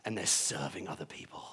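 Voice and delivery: whispered wildlife narrator watching a rare creature; soft, low documentary hush, as if spotting an animal; hushed nature documentary. Pretend.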